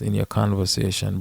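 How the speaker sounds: usual speaking voice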